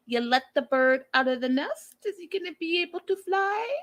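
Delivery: hammed-up rhetorical question, soaring high; mock-dramatic. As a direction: climb to a high, theatrical question. Mock dramatic question, then laughs at herself.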